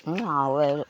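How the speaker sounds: ordinary delivery, nothing marked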